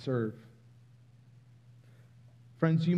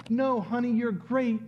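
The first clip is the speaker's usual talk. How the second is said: higher, reassuring parent voice; comforting parent; 6 semitones higher than usual. Retold or imitated. Parent reassuring a struggling child, higher and sweet. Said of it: "Most parents might say something like".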